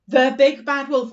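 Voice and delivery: storyteller introducing the wolf; dramatic announcement. Shown as a demonstration. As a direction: boom out the villain's name.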